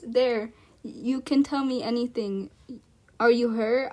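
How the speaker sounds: ordinary delivery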